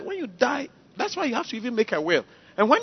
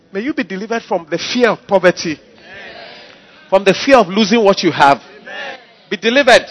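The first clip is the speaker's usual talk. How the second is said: loud fervent declaration; preaching at full force; 7 dB louder than usual. Acted. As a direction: proclaim deliverance over the crowd. Preacher declaring deliverance over congregation.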